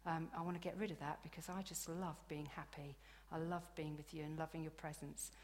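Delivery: soft whispered prayer. Acted. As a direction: breathe the prayer in a hush. Intimate prayer to Holy Spirit.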